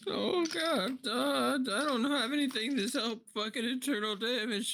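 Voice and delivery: high, tearful in-character panic; panicked wail. Acted. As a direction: wail helplessly over a convulsing friend. Player voices panic at convulsing companion.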